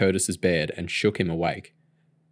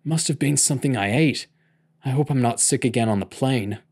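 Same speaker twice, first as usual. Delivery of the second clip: put-on queasy teen; 4 semitones higher than usual. A narrator's reading. Hungover teen after vomiting.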